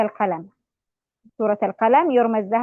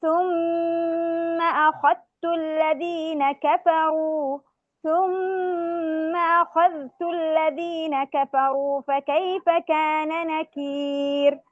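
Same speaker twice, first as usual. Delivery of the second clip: slow chanted verse, high and drawn out; Quranic recitation; 4 semitones higher, much slower than usual. Shown as a demonstration. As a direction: recite ثم أخذت الذين كفروا as tarteel. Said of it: "نقرأ بالراويين". (ترتيل الآية ببطء)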